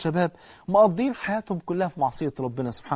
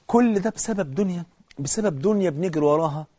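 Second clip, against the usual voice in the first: ordinary delivery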